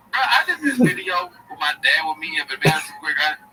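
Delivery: Schultz impression. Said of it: "Schultz is his character".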